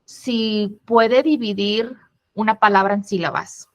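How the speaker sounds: ordinary talk, nothing marked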